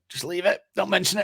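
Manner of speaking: high begging voice; high mock plea. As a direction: squeak out a plea to drop it. Inner voice, wanting to dodge.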